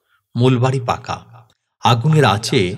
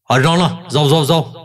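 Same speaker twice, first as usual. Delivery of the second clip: higher, agitated dismissal in short bursts; angry voice; 4 semitones higher, 3 dB louder than usual. Acted. Brother heard from upstairs chasing someone off, angry. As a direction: drive someone away angrily. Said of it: "দাদার ক্রুদ্ধ গলা".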